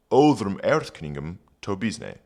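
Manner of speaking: put-on reading Old English aloud; careful Old English recitation. Shown as a demonstration. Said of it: "rereading the Old English".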